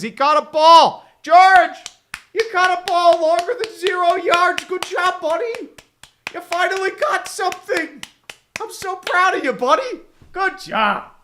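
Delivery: put-on high, condescending praise like to a toddler; mock praise. Streamer mockingly praising receiver George after a rare catch, high and patronizing. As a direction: patronize him, sing-song proud parent, up high.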